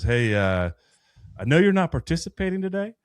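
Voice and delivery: high, rising New Zealand lilt; New Zealand accent. Retold or imitated. New Zealand leader approaching a volunteer who sat out, imitated New Zealand accent. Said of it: "he had the accent".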